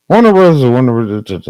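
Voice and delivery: loud, high frustrated yell. Real, not acted. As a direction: shout it out in exasperation. Frustrated outburst at search results.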